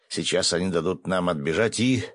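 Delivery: usual speaking voice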